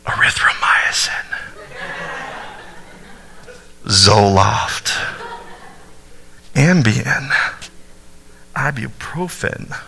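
slow, breathy whisper into her ear; sweet nothings. Acted. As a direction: whisper each word like a love note. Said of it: "whenever I wanna whisper sweet nothings into her ear".

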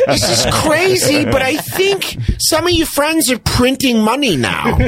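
mock wife voice, way up high; nagging wife. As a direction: screech it like a hammed-up nagging wife. Acted nagging wife continues.

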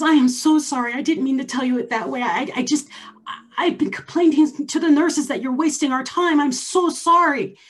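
flustered apology. Retold or imitated. Doctor begging the patient's pardon, high and guilty. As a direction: plead forgiveness in a rush of guilt.

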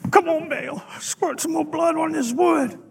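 hammed-up straining plea; mock pleading. Bleeding prophet begging Baal for fire, mock pleading. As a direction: coax Baal with comic desperation.

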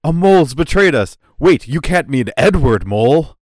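loud, hammed-up betrayal, slowing on the name; mock-dramatic shock. Podcaster parodying the team discovering a traitor, mock-dramatic. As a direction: play the big reveal for laughs.

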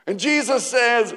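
ordinary delivery

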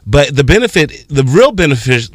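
ordinary delivery

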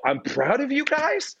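very high, drawn-out question; high rising self-mocking echo; incredulous self-mockery. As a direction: squeal it upward in mock disbelief. Repeats blurt, mortified after he leaves.

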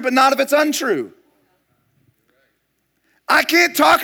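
loud, high-pitched preacher drive, pushing each phrase; preaching at full force. Acted. Pastor preaching hard against gossip to his congregation, loud and high. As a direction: hammer the point home from the pulpit.

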